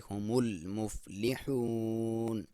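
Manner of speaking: chanted slowly, lam silent; slow recitation. Shown as a demonstration. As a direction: recite in long even phrases. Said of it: "لام کے اوپر حرکت نہیں کریں گے".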